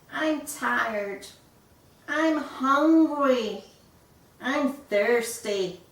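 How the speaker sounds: slow, loud, high whining complaints; whiny chick. A narrator's reading. Chick complaining to parents, slow whine. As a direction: drag out each moan like a sulky chick.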